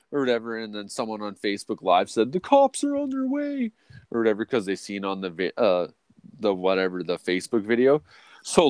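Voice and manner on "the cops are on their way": very high sing-song voice; mock viewer warning. Facebook Live viewer warning the cops are coming, high voice. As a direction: voice an online commenter warning them.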